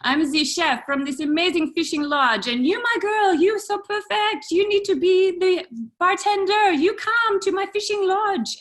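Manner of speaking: put-on loud French chef accent, climbing high; hammed-up, insistent French accent; bad French accent. French chef recruiting the bartender to his lodge, loud bad French accent. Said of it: "sorry for my French accent".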